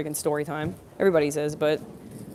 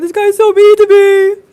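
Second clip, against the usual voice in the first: loud, high, drawn-out comic wail; hammed-up sobbing complaint, stretched out; mock crying; 13 dB louder, slower than usual. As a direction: bawl it like a hurt little kid. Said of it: "then I started crying".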